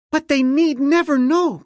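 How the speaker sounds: high, loud eager cry; cried out. A narrator's reading. Slave girl eagerly reassuring the princess, high and loud. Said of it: "cried Panatlee".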